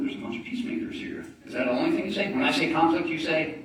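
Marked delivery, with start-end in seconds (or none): 1.4-2.3 loud, teasing prod for more; hyped crowd warm up. Acted. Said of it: "I wanna hear back from you". 2.3-3.6 loud, high call for a response; hyped crowd warm up. Acted. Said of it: "I'm gonna say a phrase".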